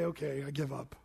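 a meek, hushed plea; humble surrender. Retold or imitated. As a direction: hand it over to God gently. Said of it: "the Lord would have you say".

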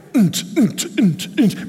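mock thumping bass beat; party beat. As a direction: imitate dance music thumping. Mimics party music beat.

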